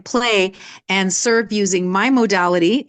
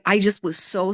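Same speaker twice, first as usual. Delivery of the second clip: usual speaking voice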